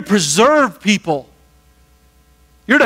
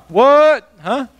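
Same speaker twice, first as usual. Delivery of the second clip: mock startled what; 5 semitones higher than usual. Mock Jesus reacting to insult.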